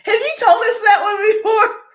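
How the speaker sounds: real laughter, loud and high; can't get through it for laughing; squealing with laughter through the words; laughing hard. Real, not acted. Cracking up at own joke.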